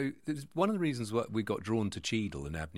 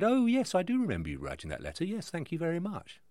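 lightly voiced Christie reply; 8 semitones higher than usual. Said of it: "she replied and said".

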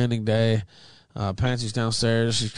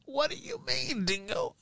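voice cracking; 10 dB quieter than usual. Real, not acted. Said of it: "Oh, my voice".

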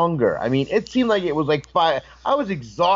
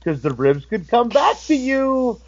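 higher, rising to emphatic; pointed warning; 7 semitones higher than usual. Retold or imitated. Wrestler warning the DJ after the rib, emphatic. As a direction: drive the lesson home, building.

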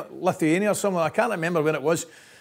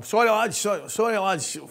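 imitating a higher-pitched sheepish apology; hasty apology; 8 semitones higher, slower than usual. Latecomer apologising to the raging boys, hasty and sheepish. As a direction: act out blurting sorry as you rush in. Late arrival apologising to waiting team.

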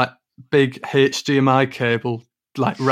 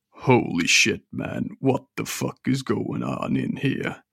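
shocked shopkeeper; 4 dB quieter, slower than usual. Acted. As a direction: gasp it out in disbelief.

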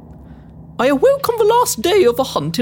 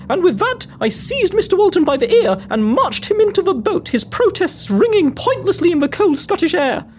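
ordinary delivery

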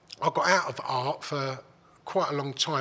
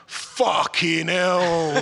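amazed exclamation; 3 semitones higher, 7 dB louder, much slower than usual. Retold or imitated. Lad recognises him, retold.

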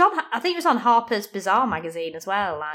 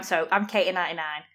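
ordinary delivery

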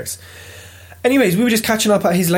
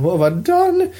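mock dismay; 10 semitones higher than usual. Acting out his regret after winning.

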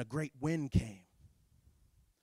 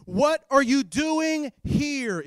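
raised, slow, drawn-out question; booming challenge; 12 semitones higher, 12 dB louder, much slower than usual. Acted. God questioning Elijah at the cave mouth, booming challenge. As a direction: call it out slowly and loudly.

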